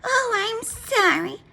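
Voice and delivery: high, slow apologetic wife voice; poor flustered wife. A narrator's reading. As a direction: say sorry like a rattled housewife. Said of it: "said poor Missus Wagon Wheel".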